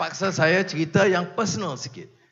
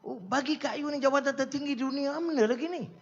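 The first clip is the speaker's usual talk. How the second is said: a retold, higher-pitched exasperated plea; upset friend pleading; 9 semitones higher, 4 dB quieter than usual. Gül upset that Anwar turns down the post, pleading in a higher voice. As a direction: play the upset minister who can't believe the refusal. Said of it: "kan dia upset".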